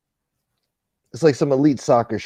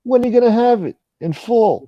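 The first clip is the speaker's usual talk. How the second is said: high-pitched English impression; mock English accent; 11 semitones higher, 4 dB louder than usual. Playing the lender asking for full repayment, mock English accent. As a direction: imitate an Englishman, pitched well up. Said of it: "he has an England accent".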